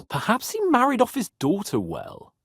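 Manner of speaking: ordinary talk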